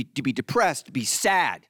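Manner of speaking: ordinary delivery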